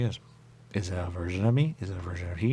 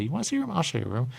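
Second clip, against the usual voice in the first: high teasing mock voice; mock voice of them; 7 semitones higher, much faster than usual. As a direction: tease it, starting up high. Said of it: "They say".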